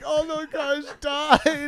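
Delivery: high, very slow, blubbering mockery; big fat guy mock-weeping over the graves; mock crying fat guy. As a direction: ham up a sobbing tourist. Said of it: "Just a big fat guy who's like".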